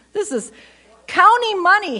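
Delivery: loud and high, county money punched out; rising outrage; indignant outburst. Real, not acted. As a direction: hit it like a scandal. Outraged emphasis on tax money.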